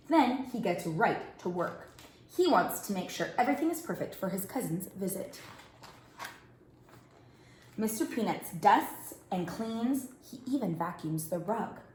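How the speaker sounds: ordinary delivery, nothing marked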